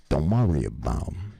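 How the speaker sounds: imitated East Coast wise guy; Pasquale. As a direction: make the offer sound like a threat. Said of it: "he says it just like this".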